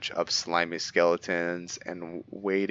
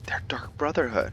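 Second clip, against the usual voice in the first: hushed unvoiced whisper; 4 semitones higher than usual. Real, not acted. Gamer sneaking past guild members, whispering. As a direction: whisper it so the guards won't hear.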